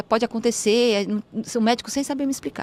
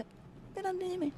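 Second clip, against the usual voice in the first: repetido baixinho; 9 semitones higher, 17 dB quieter than usual. Retold or imitated. Repete a frase, espantada.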